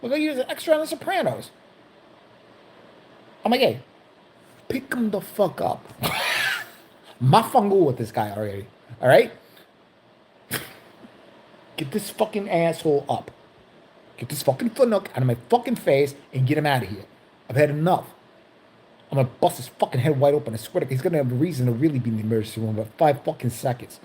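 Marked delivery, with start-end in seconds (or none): 3.6-5.8 slow Chicago Italian tough-guy order; Chicago Italian accent. Acted. Patient orders guard to lift man, slow Chicago Italian accent. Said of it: "slipping into that". 7.2-24.0 Chicago Italian tough guy, fed up; Chicago Italian accent. Acted. Security guard fed up with man on floor, Chicago Italian accent. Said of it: "lots of Italians in Chicago slipping into that".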